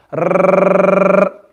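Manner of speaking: long trilled rrrr; rolled r. Shown as a demonstration. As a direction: let the tongue shiver, rrrr. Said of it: "that's what you call the shiver".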